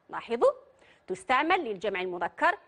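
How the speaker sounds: ordinary talk, nothing marked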